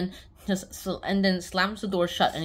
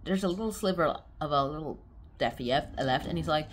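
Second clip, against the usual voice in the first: ordinary talk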